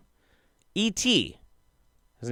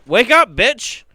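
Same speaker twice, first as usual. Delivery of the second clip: high, loud burst of irritation; loud, high-pitched scolding; angry yell at a printer; 12 semitones higher, 7 dB louder, slower than usual. Real, not acted. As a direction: yell at a machine that won't work. Yelling at a kicked printer.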